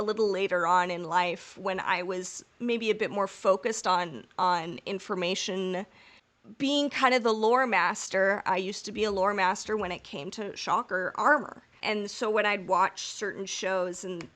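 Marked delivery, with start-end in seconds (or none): none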